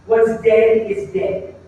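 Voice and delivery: drawn-out, very slow mourning words; slow mourners. Retold or imitated. Mourners telling Jesus it is too late, very slow. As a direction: stretch each word like grieving mourners. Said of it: "they say".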